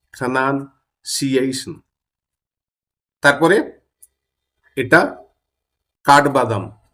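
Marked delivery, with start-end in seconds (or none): 0.1-1.8 "pronunciation" drawn out very slowly, every syllable clear; very slow model pronunciation. Shown as a demonstration. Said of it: "উচ্চারণ করুন আমার সাথে".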